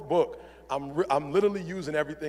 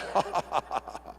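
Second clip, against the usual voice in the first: villain's mocking laugh; 5 dB quieter than usual. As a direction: laugh him off like Bane. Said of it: "And Bane's like".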